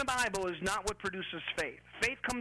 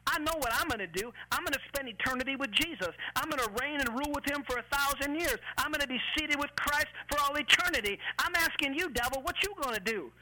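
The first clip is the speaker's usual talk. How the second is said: high-pitched, driving preacher taunting the devil; rousing sermon rebuke; fired-up preaching; 7 semitones higher than usual. Acted. Preacher talks back to the devil.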